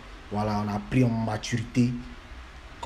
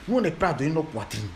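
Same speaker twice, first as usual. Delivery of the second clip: normal talking voice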